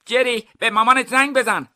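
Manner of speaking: high, loud call to someone. Acted. Thief calling to Jerry, overheard by the guard, high loud call.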